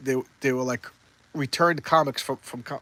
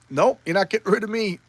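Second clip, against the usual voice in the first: defiant Thor; 6 semitones higher than usual. Retold or imitated. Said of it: "Thor's like".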